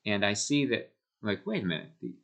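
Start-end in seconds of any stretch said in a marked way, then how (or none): none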